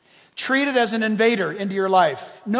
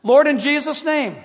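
decisive declaration; 5 semitones higher, 5 dB louder than usual. Shown as a demonstration. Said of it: "Grab it and say".

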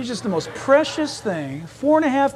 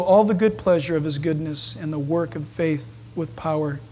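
lower, measured reading of the verse; scripture reading; 6 semitones lower, 4 dB quieter than usual. Acted. Pastor reading Paul's prayer to the congregation.

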